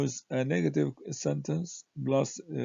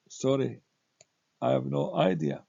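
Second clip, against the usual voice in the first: ordinary delivery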